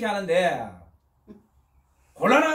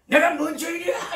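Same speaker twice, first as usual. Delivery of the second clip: much higher, laughing mock outrage; indignant protest; 9 semitones higher, 4 dB louder than usual. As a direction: scoff at being called a sinner. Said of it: "이러는 거예요".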